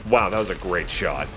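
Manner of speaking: usual speaking voice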